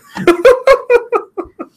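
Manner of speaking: high loud burst of laughter. Real, not acted.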